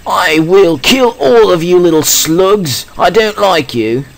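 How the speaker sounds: mock British threat to the slugs; bad British accent. Gamer taunts slugs in a bad British accent. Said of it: "I do a bad British accent".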